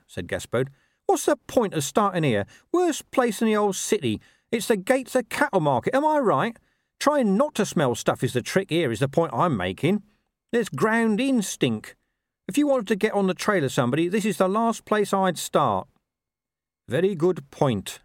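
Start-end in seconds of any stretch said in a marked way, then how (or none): 1.0-15.9 high-pitched, complaining dog character; talking dog Gaspode. Acted. 16.9-18.0 slow, careful reply in a higher voice. A narrator's reading. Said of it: "said Carrot carefully".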